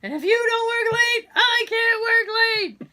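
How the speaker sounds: high-pitched yelled reply; shouted movie quote. Acted. Finishing a friend's film quote, shouted. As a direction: holler it in mock boss outrage.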